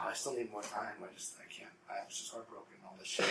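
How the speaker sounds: very soft, low mumble; mock heartbroken excuse. Houseguest refusing to leave, soft heartbroken mumbling. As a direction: murmur it like a sorry freeloader, almost whispered.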